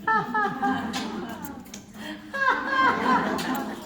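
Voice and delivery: very loud, high ha ha chorus; leading group laughter. Shown as a demonstration. Teacher gets everybody laughing together, very loud and high. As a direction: lead the room into a full laugh.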